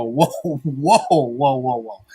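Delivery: stunned whoa through a laugh; amused disbelief. Retold or imitated. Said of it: "I go".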